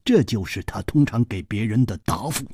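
usual speaking voice

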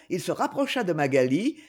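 plain reading voice

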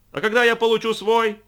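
high, loud shout. A narrator's reading. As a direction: yell the question over the noise. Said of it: "оба они орали во всё горло".

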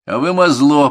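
loud, high, dismissive jab; contemptuous instructor; scornful. A narrator's reading. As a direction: toss the insult at the whole group. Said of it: "пренебрежительно бросил остальным".

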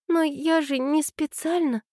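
put-on high, slow, flustered girl's voice; slow, high-pitched bewildered excuse; flustered mumble. A narrator's reading. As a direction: falter apologetically in a high young voice. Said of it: "пробормотала Аня растерянно".

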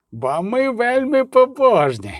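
high, drawn-out solemn declaration; pious and deadly earnest, slow; mock-serious piety. A narrator's reading. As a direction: stretch it out high with a straight-faced seriousness. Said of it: "його голос був серйозний".

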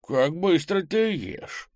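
high, slow voice of amazement; surprised granny. A narrator's reading. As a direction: marvel aloud, high and drawn out. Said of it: "удивилась бабушка". (удивлённая бабушка, высоко и протяжно)

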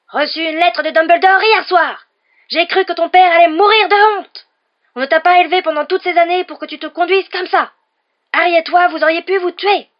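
mother roaring through a magic letter at her son; Mrs Weasley howler. A narrator's reading. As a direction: scream it like a letter bellowing at a son. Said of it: "Les hurlements de Madame Weasley".

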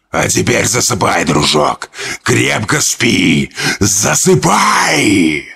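slow, drawn-out sinister lull; eerie lullaby creature voice. Acted. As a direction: coo the boy to sleep like a demon. Creature lulls boy to sleep.

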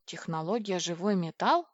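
plain reading voice

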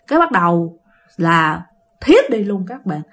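ordinary delivery

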